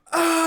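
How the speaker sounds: high, hammed-up child's wail; imitation crying "aah"; mock crying wail. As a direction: wail like a kid in tears, exaggerated. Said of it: "and he's crying".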